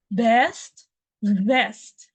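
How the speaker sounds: Best with lips closed, vest with teeth on lip; best then vest, clear contrast; B versus V contrast. Shown as a demonstration. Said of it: "I will say it first".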